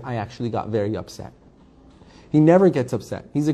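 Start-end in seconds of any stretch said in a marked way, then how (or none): 0.0-1.3 soft, quick, calm admission. Retold or imitated. Rabbi imitates the calm teacher admitting he got upset, soft and calm. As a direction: say you were upset as calmly as possible. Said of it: "In a very calm voice".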